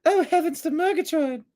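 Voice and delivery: high, breathy-free mock belle gasp; prim mock Southern lady exclaiming. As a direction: clutch your pearls like a Southern belle. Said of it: "kinda like a Southern belle".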